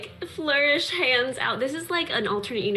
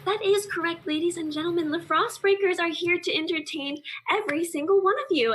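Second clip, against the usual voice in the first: higher showman's pitch; showy assistant; 6 semitones higher, faster than usual. Acted. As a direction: play to the crowd with flair. Rhaenyra performs for crowd.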